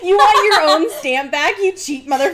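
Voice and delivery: loud, high laughing jab; laughing mock outrage. Real, not acted. Laughing as she mocks her friend for being cheap. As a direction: tease her through laughter. Friend hopes to get stamp back.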